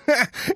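high, shrill crazed laughter; shrill mad laugh. Acted. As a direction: cackle like a madman. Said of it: "визгливо, точно безумец, захохотал".

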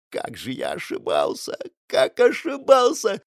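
slow, anguished high wail; despairing wail. A narrator's reading. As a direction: cry it out in despair. Paksus breaks down in despair.

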